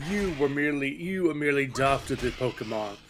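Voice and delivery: hammed-up masked villain, a touch of Bane; Bane impression. Streamer parodying Bane's speech for chat, mild villain voice. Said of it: "This is my Bane impression".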